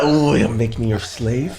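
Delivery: slow, soft hammed-up sultry plea; mock seductive. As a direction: tease like a bedroom role play, slowly. Repeats mock roleplay line.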